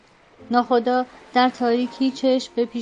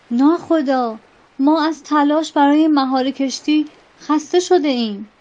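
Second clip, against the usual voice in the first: louder, frantic call to the captain; panicked shout; 3 semitones higher, 5 dB louder than usual. Acted. Sailors crying out to their captain, frantic shout. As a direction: shout it in alarm over the storm. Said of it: "ملوانان سراسیمه فریاد زدند".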